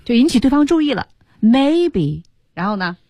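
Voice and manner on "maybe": maybe 重读后下降; stress on maybe. Shown as a demonstration. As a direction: hit maybe firmly. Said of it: "一下强调出来了". (重读 maybe)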